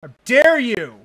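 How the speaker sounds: loud outraged demand; outraged, loud but held back; shaking with anger. Real, not acted. First outraged cry.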